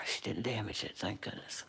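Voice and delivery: soft, low relieved whisper; whispered relief under the breath. Real, not acted. Relieved after scare.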